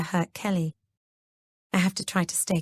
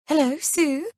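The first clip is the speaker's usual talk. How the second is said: high, trembling mock fear; pretending to be scared, high and tremulous; fake terrified voice; 8 semitones higher than usual. A narrator's reading. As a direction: put on a terrified voice for the phone. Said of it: "putting on a terrified voice, she says tremulously".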